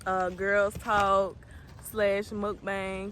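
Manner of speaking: very slow, stretched-out words; slow drawl. Real, not acted. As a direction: drag each word out slowly. Slow drawn-out announcement.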